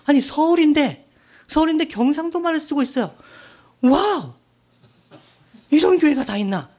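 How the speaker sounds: high-pitched amazed reaction; astonished. Retold or imitated. Preacher recalling his first visit to a Seoul church, astonished. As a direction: relive your disbelief, punching the exclamation. Relives shock at dialect-filled church.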